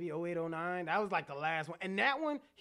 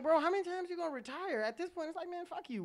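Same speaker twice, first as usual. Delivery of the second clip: mock Martin Lawrence; 11 semitones higher than usual. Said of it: "I'm like".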